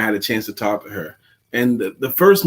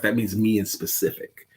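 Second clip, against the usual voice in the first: normal talking voice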